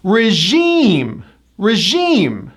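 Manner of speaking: Regime as ruh-ZHEEM; second-syllable stress. Shown as a demonstration. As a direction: hit and stretch the end of Regime. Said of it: "The second syllable gets the stress".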